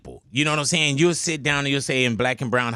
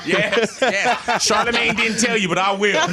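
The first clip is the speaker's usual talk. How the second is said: laughing; 7 semitones higher, 3 dB louder than usual. Real, not acted. Teasing about a Jamaican accent.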